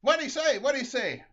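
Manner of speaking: high, loud shout from the car; shrill-pitched yell demanding to be told; loud asking. Acted. Wife calls to husband through car window, loud high shout.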